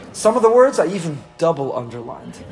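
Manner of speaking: ordinary talk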